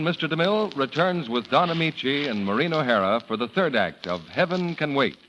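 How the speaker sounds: normal talking voice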